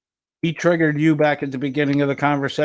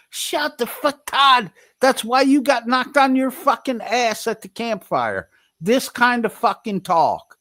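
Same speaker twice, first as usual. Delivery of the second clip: angry outburst; 9 semitones higher than usual. Real, not acted. Telling Todd off about the campfire fight, a high angry yell. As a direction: shout him down, much higher.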